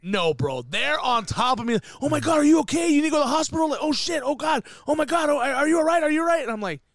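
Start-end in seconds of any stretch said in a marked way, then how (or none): 2.0-6.4 higher, louder, fussing over him; mock panicked crew. Crew crowding the fallen host, mock panic. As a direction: play the worried crew rushing in.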